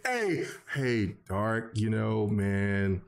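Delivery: low, slow, soft put-on voice; mocking imitation. Retelling how the co-host asked to join, mocking imitation. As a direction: imitate him dragging out a sheepish request. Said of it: "I didn't say it like that".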